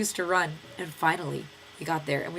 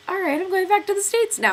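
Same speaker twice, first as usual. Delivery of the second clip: breezy advisor; 14 semitones higher, 7 dB louder than usual. Pretend. Imagined advisor leaving.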